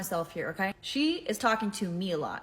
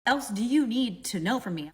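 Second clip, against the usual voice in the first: retold incredulous comeback, stretched words; fed-up retort, leaning on the words; exasperated; 2 semitones higher than usual. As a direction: push back in exasperated disbelief. Retold exasperated reply to demand for proof.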